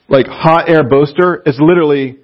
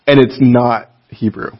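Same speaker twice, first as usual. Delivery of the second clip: ordinary talk, nothing marked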